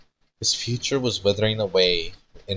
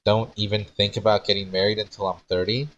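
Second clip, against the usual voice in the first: ordinary delivery, nothing marked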